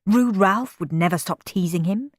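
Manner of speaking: usual speaking voice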